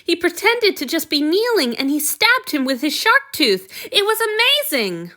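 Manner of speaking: high, loud and breathless with excitement; a boy bursting to tell the story; excited boy. Acted. Boy excitedly recounting a fight.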